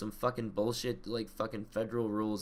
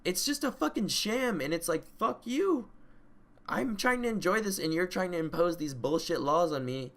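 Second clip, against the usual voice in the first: fired-up rant; 6 semitones higher than usual. Real, not acted. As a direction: rail against the rules. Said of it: "I'm fired up".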